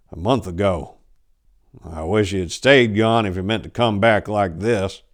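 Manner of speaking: ordinary delivery, nothing marked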